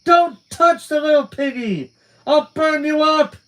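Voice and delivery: shrieking very high and loud, slowly; frantic yell. Acted. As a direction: scream it in panic and rage. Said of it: "But Alice yelled".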